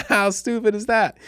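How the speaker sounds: loud, high laugh then a laughing question. Real, not acted. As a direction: crack up at your own dumb story. Laughing at own story.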